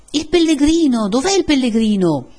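loud, high searching cry; calling out. A narrator's reading. Villagers look for the pilgrim, calling out. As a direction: shout for someone missing.

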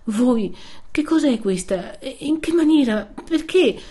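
high, bewildered questions; overwhelmed, voice rising with each question; trembling astonishment. A narrator's reading. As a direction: ask in amazement, pitch climbing. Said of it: "replicò ancora più tremante Lucia".

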